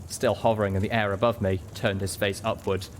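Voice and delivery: ordinary delivery, nothing marked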